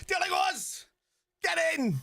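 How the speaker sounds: high-pitched, real roar of joy; explosive football fan's cheer; goal celebration shout. Real, not acted. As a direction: shout it out as your team scores. Celebrating a goal live on stream.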